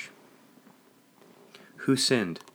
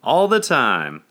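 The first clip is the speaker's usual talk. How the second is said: slow, loud, higher answering shout; 5 semitones higher, 9 dB louder, slower than usual. A narrator's reading. Congregation spurring the guest on, shouted very loudly. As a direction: belt the response back. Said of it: "shouted back very loudly".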